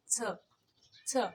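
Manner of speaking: छ said as a crisp ch sound; initial sound demo. Shown as a demonstration. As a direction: sound out छ clearly, like ch. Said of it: "ये छ की आवाज़ निकालता है".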